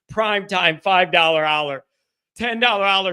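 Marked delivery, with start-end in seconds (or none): none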